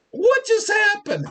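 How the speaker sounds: high, incredulous cry of disbelief. Retold or imitated. Host reacting to a headline, shocked high cry. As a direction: squeal the catchphrase in shock. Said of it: "I'm like".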